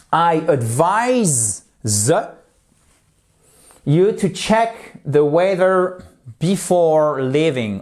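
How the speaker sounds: slow, careful teacher reading; slow model sentence. Shown as a demonstration. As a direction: lay each word out clearly. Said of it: "lesson example sentence read slowly". (lecture lente d'un exemple)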